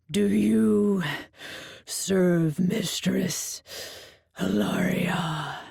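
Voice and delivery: roaring Minotaur. A narrator's reading. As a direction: roar the name like a bull. Minotaur demands.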